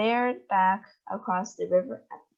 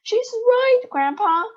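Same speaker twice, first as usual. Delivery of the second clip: put-on high, louder boyish cry; excited cry; 6 dB louder, slower than usual. A narrator's reading. Charlie confirming to Grandpa, excited cry. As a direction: call out to Grandpa thrilled. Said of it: "cried Charlie".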